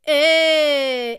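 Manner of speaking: loud held call, gently falling; downward glissando. Shown as a demonstration. Teacher repeating the spoken exercise, loud gliding call. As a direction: call out long and let it slide. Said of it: "on glisse vers le bas".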